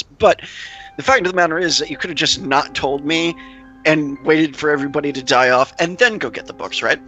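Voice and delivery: ordinary talk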